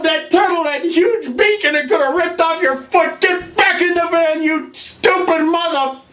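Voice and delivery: high, exasperated yelling; furious scolding. Acted. Plumber berating his brother for risking his life, furious yell. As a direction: explode at him, pitch way up.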